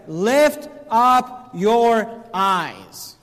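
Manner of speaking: very slow, each word set apart; slow, emphatic quote. Acted. Preacher pointing listeners to the wording, slow and emphatic. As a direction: draw out each word for weight.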